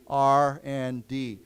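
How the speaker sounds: slow, clear R and D; model pronunciation. Shown as a demonstration. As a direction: spell out R and D letter by letter, slowly. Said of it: "we often say just R and D".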